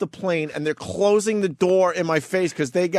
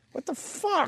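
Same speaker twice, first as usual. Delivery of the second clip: incredulous; 14 semitones higher, 6 dB quieter, slower than usual. Retold or imitated. Retold reaction to bumping.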